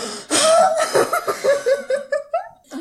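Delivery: very high, loud burst of laughter; shrieking laughter. Real, not acted. Game master reacting to kiss teasing, laughing hard. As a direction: crack up in a long high peal. Teased about kissing the date.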